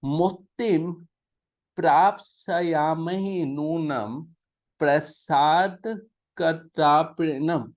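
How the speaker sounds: slow, deliberate Sanskrit verse reading; slow scripture reading. Shown as a demonstration. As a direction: spell out each word of the shloka slowly. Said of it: "अगली line". (धीमा श्लोक पाठ)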